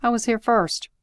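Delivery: plain reading voice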